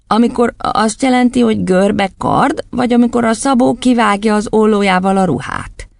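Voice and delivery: put-on high child's voice; schoolboy, questioning. Boy asking the headmistress which word, high voice. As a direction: ask up high like a bright boy. Otto asks back.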